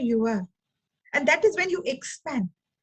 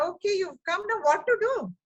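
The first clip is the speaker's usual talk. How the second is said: resigned shrug; 7 semitones higher than usual. Retold or imitated. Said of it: "I say".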